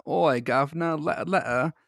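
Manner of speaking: a hammed-up Aussie "Oi, governor" in a bad Australian accent; clumsy fake Australian accent; mock Australian accent. Friend mocking the doll in a bad Australian accent. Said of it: "my accent is impeccable".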